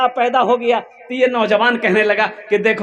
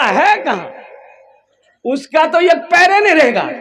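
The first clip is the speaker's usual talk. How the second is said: angry threat; 8 semitones higher, 4 dB louder than usual. Acted. Youngest son threatens father.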